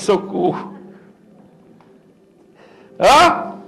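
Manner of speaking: normal talking voice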